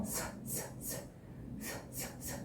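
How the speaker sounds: a long, unvoiced hiss; hissing s sound. Shown as a demonstration. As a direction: hiss a plain s without voice. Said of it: "quando você emite esse som". (s sibilado sem voz)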